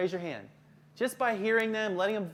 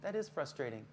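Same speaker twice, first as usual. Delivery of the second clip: commiserate quietly as a fellow parent; understanding, confiding tone; empathizing; 2 semitones lower, 6 dB quieter than usual. Shown as a demonstration. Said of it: "talk about how you feel".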